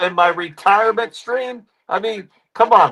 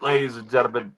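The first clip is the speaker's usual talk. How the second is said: much lower and softer; much lower, softer catchphrase; deep showman tag; 9 semitones lower, 8 dB quieter, faster than usual. Acted. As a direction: sink into a low announcer murmur. Character catchphrase.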